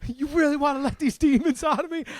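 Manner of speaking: high, unhinged movie quote; Danny McBride. Retold or imitated. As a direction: quote it like a wound-up hothead. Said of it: "like Danny McBride in".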